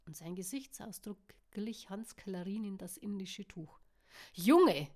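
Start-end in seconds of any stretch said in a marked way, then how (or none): 4.3-4.9 louder, brisk call; loud sharp call across the bar. A narrator's reading. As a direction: snap the word out to stop him. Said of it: "rief Luke forsch".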